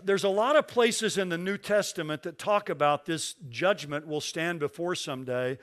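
usual speaking voice

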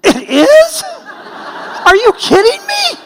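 preacher reliving his shocked delight, high and laughing; stunned disbelief. Retold or imitated. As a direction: squeal it like you can't believe it worked. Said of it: "And I felt like saying".

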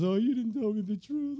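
high put-on wailing complaint; hammed-up soap opera tearful whine; Delilah. Delilah soap opera-ing Samson for the truth, mock wailing. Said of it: "just constantly crying and wailing".

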